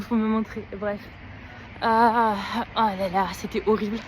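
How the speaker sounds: ordinary talk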